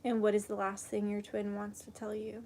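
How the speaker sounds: soft, hushed meditation voice; guided meditation. Acted. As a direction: guide them gently into calm. Leads a meditation and prayer.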